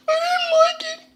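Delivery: very high, drawn mock voice; comic squeal. Reviewer repeats his verdict on the interview, in comic squeal. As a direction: squeak it out in an exaggerated falsetto.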